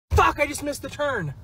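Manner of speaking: ordinary talk, nothing marked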